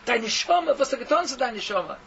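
loud, high and accusing; roaring rebuke. Retold or imitated. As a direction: roar the question at the sinner. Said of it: "with his roaring voice".

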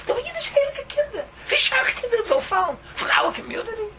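sermon turned to sobbing lament; breaking into tears. Real, not acted. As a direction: weep the words out. Weeping over lost children.

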